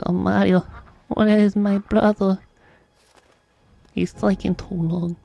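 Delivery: a mild, worried little plumber; Luigi voice. Retold or imitated. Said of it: "Luigi's like".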